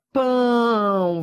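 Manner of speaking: "pão" drawn out, gliding into a U sound; som do ditongo. Shown as a demonstration. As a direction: stretch "pão" so the final U is heard. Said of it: "quando eu digo assim". ("pão" alongado, terminando em som de U)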